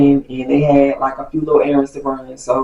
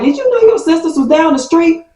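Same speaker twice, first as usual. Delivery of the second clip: alarmed mom; 14 semitones higher than usual. Retold or imitated.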